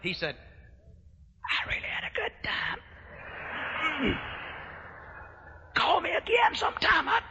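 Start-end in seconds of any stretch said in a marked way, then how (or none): 1.4-7.2 high, strained, voiceless squeak; imitated shouted-out voice, cracking high; worn-out voice. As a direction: croak it out with the voice nearly gone. Passenger worn out after night of shouting.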